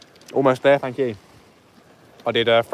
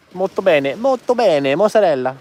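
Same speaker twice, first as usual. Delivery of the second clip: high, playful fake Italian; mock Italian accent; 6 semitones higher, 3 dB louder than usual. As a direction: ham up an Italian accent, higher and lively.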